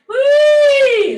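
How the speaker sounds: high loud siren whoop; joyful loud wee; playful whee. Shown as a demonstration. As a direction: swoop up like a siren, full voice. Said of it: "Let it be a playful sound".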